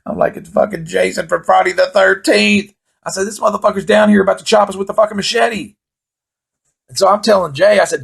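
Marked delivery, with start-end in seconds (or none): 0.4-5.8 high-pitched, hammed-up alarm; retold panic. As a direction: play up the terror of a killer outside. Retelling panic inside the tent.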